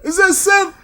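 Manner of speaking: high, laughing daze; mock woozy friend on a stretcher; dazed injured friend. Injured friend spotting Seth from the stretcher, high and giddy. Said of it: "he's like".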